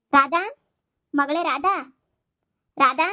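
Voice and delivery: loud call across the house, rising at the end. Acted. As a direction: call the daughter's name from outside. Mother calls daughter from outside. (ಜೋರಾಗಿ ಕೂಗಿ ಕರೆಯುವುದು)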